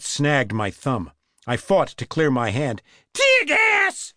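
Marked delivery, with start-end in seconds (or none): 3.1-4.1 very high scream. A narrator's reading. Maced woman screaming at the cop, high shriek. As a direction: shriek it out far above normal pitch. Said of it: "she screamed".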